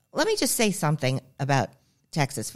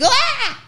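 sudden high-pitched shriek; short high scream; 4 dB louder than usual. Retold or imitated. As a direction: scream in fright at a bug. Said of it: "I screamed".

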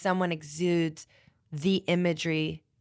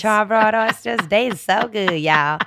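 louder, playful New Orleans accent on the oysters; 3 semitones higher, 6 dB louder than usual. Shown as a demonstration. Podcast guest raving about oysters, in a hammed New Orleans accent. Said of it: "I could put that accent on real quick".

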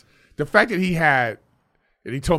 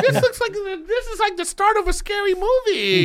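high, strained voice of mock fright; spooked squeal. Real, not acted. Friend squealing that the ape video looks like a horror film.

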